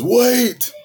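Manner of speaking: high alarmed wait; urgent stop. Retold or imitated. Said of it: "And he goes".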